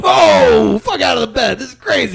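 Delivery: high, loud shouted quote; mock yell. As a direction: yell it like stopping a brawl. Retelling breaking up a fight.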